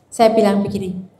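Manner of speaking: ordinary delivery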